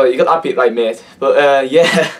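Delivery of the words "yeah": ordinary talk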